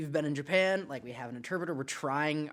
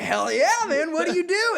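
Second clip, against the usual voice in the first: loud, high-pitched hype; over-excited; 12 semitones higher, 7 dB louder than usual. Retold or imitated. Tourist asking the bartender his job, loudly excited. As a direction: cheer him on at full volume. Retold eager reply to bartender.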